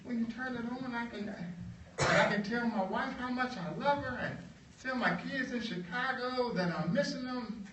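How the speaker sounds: lifted high, hopeful worker's voice; an amazed worker checking he can speak freely; John, eager. Retold or imitated. John asks the boss if he can really say anything, high and hopeful. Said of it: "John says".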